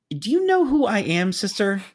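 very high, indignant mock yell; imitated screaming. Planner pulling rank on the phone, screaming. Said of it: "continued to scream at me".

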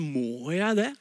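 a grudging, half-whining question; reluctant sigh. Retold or imitated. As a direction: mimic a reluctant listener. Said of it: "responsen hos oss er ofte sånn". (motvillig, litt sutrete spørsmål)

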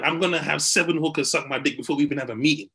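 ordinary talk, nothing marked

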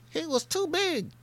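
very high, thin mock innuendo voice; suggestive mock echo. Echoing his own line as a that's-what-she-said joke. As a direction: say it back like a naughty joke. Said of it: "that's what she said".

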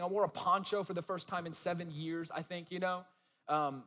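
ordinary talk, nothing marked

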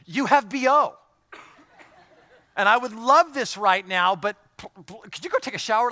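high, loud mock complaint about body odour; put-off wife. A pastor voicing a wife asking her man to shower, high and loud. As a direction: play the wife begging him to shower, hammed up. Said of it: "hurdles like".

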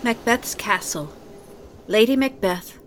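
ordinary talk, nothing marked

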